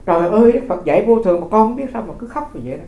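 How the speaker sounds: mildly exasperated monk, pitch lifted; chiding consolation. Retold or imitated. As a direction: gently scold the mourners, voice rising. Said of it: "cái sư đi đâu sư cũng khuyên vậy".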